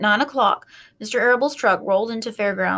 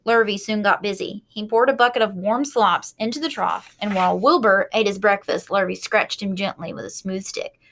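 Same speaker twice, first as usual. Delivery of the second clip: ordinary delivery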